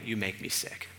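low, soft reproach; quiet rebuke. Acted. Jesus coming to the church in rebuke, hushed and low. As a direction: drop right down and say it quietly.

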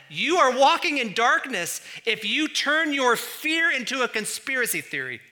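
loud, high-pitched reproach, easing at the end; stern rebuke. Acted. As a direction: thunder it like God warning his people. Voices God rebuking his people.

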